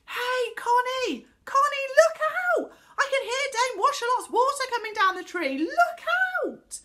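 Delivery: high, urgent warning shout; loud yell. A narrator's reading. Boy warns the girl of falling water, yelling. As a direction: yell a warning at full volume. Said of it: "he yelled loudly to Connie".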